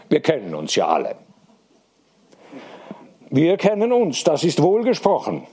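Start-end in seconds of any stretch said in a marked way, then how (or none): 3.3-5.5 higher, solemn pronouncement; solemn approval, pitched up. A narrator's reading. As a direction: declare it with raised, ceremonious pitch. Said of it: "sagte Hediger feierlich".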